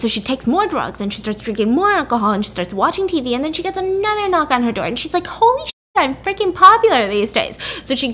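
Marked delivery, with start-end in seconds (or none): 5.3-7.6 high, loud amazed exclaim; surprised excitement. Retold or imitated. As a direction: blurt it out, delighted and shocked. Surprised by another knock.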